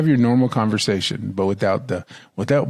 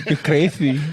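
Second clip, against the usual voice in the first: mock Mexican accent; 2 semitones higher than usual. Trading mock Mexican accent lines.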